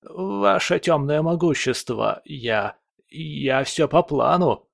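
cowering, stumbling over the words; frightened stammer. Acted. Servant stammers before his master.

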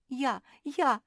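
high, eager clamour; yelling. A narrator's reading. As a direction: beg loudly to be chosen. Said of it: "завопила Куки".

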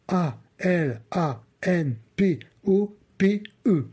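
spelled. Acted. Spelling out letters slowly.

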